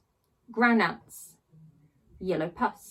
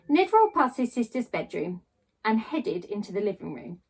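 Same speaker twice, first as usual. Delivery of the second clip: plain reading voice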